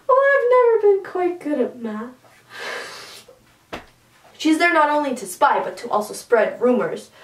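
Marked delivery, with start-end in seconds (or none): 0.1-2.1 cheeky quip. Retold or imitated. Vin's cheeky reply.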